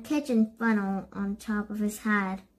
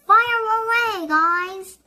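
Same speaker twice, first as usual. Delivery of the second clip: high, loud rallying shout; rally the troops to attack; tree ordering the others to throw apples; battle cry; 10 semitones higher, 6 dB louder, slower than usual. A narrator's reading.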